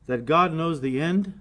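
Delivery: ordinary talk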